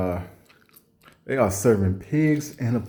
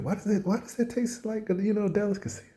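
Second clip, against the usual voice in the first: high falsetto puzzled question; high, softer baffled mock question; mock wonder; 11 semitones higher, 5 dB quieter than usual. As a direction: squeak it up in exaggerated disbelief. Mock wonder about crab poop.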